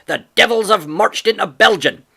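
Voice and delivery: a gruff farmer crowing the news of war; father shouting to his wife across the yard; excited shout. A narrator's reading.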